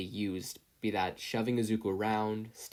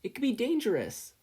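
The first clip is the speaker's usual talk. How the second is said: panicked warning; 14 semitones higher, 4 dB louder than usual. A narrator's reading. Izuku pleading for his book.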